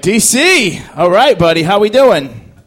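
loud, high, buddy-buddy hello; cheerful greeting. Retold or imitated. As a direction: greet him like an old pal. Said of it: "I'm like".

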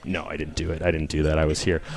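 very soft, low mock-innocent mumble; mock denial. Comic pretending to deny a murder, low and soft. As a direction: mutter your alibi. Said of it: "denies the crowd mock-guiltily".

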